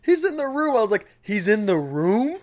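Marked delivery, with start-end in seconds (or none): 0.0-0.8 put-on high, matter-of-fact kids. Quoted kids answering. 1.2-2.4 slow, drawn-out disbelief; slow, incredulous echo; disbelieving. Retold or imitated. Quoted self in disbelief.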